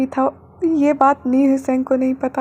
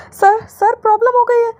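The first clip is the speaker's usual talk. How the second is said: high, louder alarmed blurt; panicked assistant; 9 semitones higher, 4 dB louder than usual. A narrator's reading. Assistant bursting in with bad news, panicked. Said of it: "घबराते हुए".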